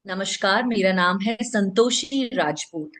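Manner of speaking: measured, distinct "Santoshi Rajput"; clear name introduction. Shown as a demonstration. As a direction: give "Santoshi Rajput" room so each word is heard. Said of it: "नाम बहुत specifically सुनाई देना चाहिए". ("संतोषी राजपूत" साफ़, ठहरकर बोलें)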